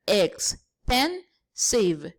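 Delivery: expensive drawn out slowly, syllable by syllable; slow syllable count. Shown as a demonstration. Teacher counting syllables slowly for students. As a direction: spell out expensive slowly for the class. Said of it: "three syllables".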